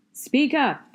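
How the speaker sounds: high, loud teacher order; loud teacher telling a shy boy to speak up; stern command. Acted. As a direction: demand he speak up. Teacher scolds shy student.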